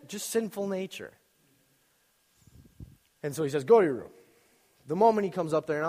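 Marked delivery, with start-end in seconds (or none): 3.6-4.1 loud mock parental order; imitated stern dad. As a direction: order the child off as an angry dad. Father sends the child away.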